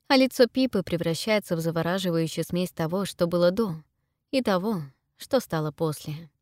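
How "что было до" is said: usual speaking voice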